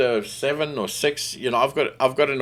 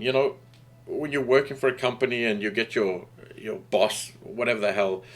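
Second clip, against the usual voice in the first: usual speaking voice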